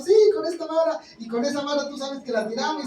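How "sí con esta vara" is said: God's firm answer. Acted. God answers Moses in a story.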